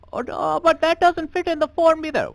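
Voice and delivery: put-on high, fretful clerk voice in an Indian accent; fussy clerk. Clerk frets the name won't fit the form, high and fussy.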